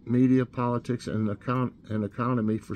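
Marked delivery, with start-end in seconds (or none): none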